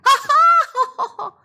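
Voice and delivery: hearty high laugh; loud laughter. Acted. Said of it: "They laughed loudly, really loudly".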